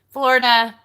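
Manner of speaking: ordinary talk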